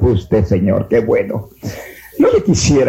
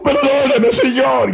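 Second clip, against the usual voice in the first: tearful plea; 13 semitones higher, slower than usual. Retold or imitated. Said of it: "entre lágrimas y entre llanto".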